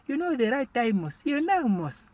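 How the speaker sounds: high, teasing sing-song; mock knowing friend. Comedian mimics friends dodging his question, high and teasing. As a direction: lift the voice up and tease. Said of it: "people are just beating around the bush".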